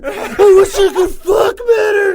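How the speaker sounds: anguished shout. Retold or imitated. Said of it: "and he's like".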